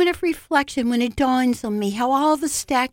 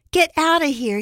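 higher, mildly threatening call; 5 semitones higher, 3 dB louder than usual. A narrator's reading. Anthony warning her off his porch, mildly threatening. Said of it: "he calls threateningly".

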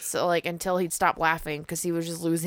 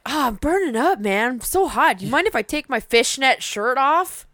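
louder, hammed-up sleazy come-on; hammed-up punk kid; 6 semitones higher, 5 dB louder than usual.

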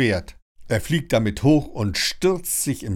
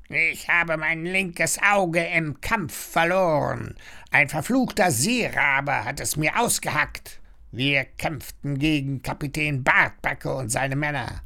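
parrot storyteller; 5 semitones higher than usual. A narrator's reading. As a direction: recount the battle like an old pirate bird. Parrot tells his pirate war story.

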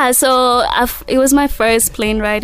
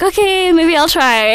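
higher, a shy retold okay, maybe; imitating her own unsure yes, pitched up; hesitant yes; 7 semitones higher, 4 dB louder than usual. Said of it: "And I was like".

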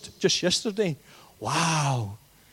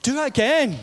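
excited; 6 semitones higher than usual. Retold or imitated. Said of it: "I'm like".

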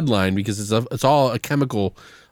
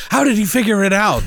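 high, raised-voice mock exasperation; exasperated disbelief; 8 semitones higher, 4 dB louder than usual. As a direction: act out a baffled outburst. Acted exasperated movie character.

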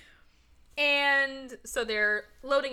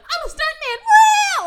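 high shout into a loud wail; fleeing stuntman; 15 dB louder than usual. Retold or imitated. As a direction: yell it while diving out a window. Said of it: "that person is just fucking like".

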